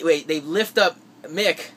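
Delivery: ordinary talk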